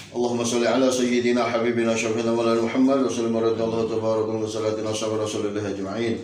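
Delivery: fast murmured Arabic prayer; prayer recitation. Real, not acted. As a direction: rattle off the prayer quickly.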